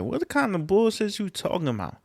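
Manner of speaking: high-pitched, incredulous mock question; incredulous group reaction. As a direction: voice shoots way up in disbelief, imitating stunned friends. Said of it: "looking at him like".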